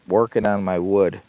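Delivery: normal talking voice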